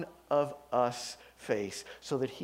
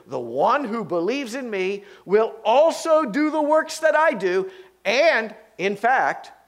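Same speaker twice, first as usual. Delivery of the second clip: loud, rising pulpit reading of the verses; scripture preaching; 7 semitones higher, 8 dB louder than usual. Acted. Preacher reading Jesus' words to the congregation, loud and rising. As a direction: proclaim the promise to the congregation.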